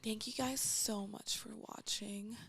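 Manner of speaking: slow whispered sign-off; whispered outro. Pretend. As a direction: whisper the goodbye to viewers.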